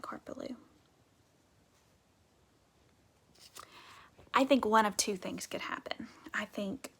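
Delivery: soft classroom whisper. Real, not acted. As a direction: lean in and whisper. Whispered teacher aside.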